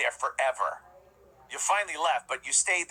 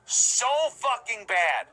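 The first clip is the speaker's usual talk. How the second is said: slow, louder, every word hit; emphatic repeat; 7 semitones higher, 4 dB louder, much slower than usual. Acted. Host slams John's announcing, slow heavy stress on each word. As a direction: drag it out with disgust.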